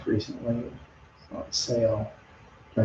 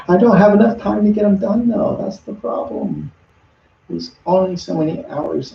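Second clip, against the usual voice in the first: laughing; 9 semitones higher than usual. Real, not acted. Laughing at own lack of time.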